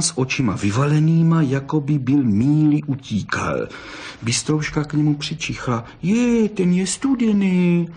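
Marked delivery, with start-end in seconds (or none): 6.0-7.9 put-on high, drawn-out childlike exclamation; slow, high squeal of discovery; surprised cry. A narrator's reading. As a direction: cry out like an amazed little one. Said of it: "vykřikla".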